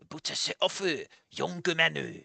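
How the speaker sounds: soft old man in a fake Japanese accent. Old man addresses the young visitor, fake Japanese accent. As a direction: put on a bad Japanese accent, low and quiet. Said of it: "I knew this man wasn't a real Japanese man".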